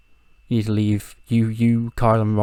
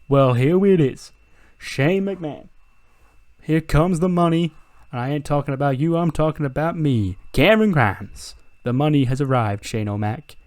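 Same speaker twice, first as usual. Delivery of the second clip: Cameron Grimes voice; 5 semitones higher, 4 dB louder than usual. Retold or imitated. To Shane McMahon, boasting Cameron Grimes rolls in.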